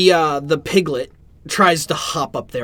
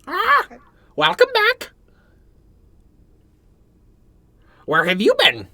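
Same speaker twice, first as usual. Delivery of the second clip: much higher, louder bird voice; the bird greets the party, high and loud; talking bird; 4 dB louder than usual. Acted. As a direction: chirp it out like a parrot.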